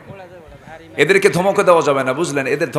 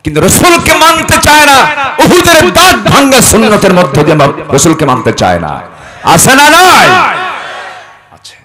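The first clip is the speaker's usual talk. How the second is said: loud, high, rising preacher's tirade; preacher denouncing hypocrites; preaching at full force; 5 semitones higher, 8 dB louder than usual. Acted. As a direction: build the condemnation to a peak. Building sermon condemnation.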